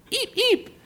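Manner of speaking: very high, soft squeak; mouse squeak. Acted. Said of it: "said Butter".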